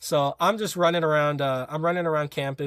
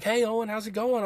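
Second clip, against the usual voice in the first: friendly coach greeting; 8 semitones higher than usual. Retold or imitated. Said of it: "he's like".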